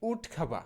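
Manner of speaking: উড খাভা with aspirated kh and no r; corrected pronunciation. Shown as a demonstration. Teacher correcting woodcarver, উড খাভা ending in open "va". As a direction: say উড খাভা as "wood KHA-va". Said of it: "ইংরেজি হবে কি".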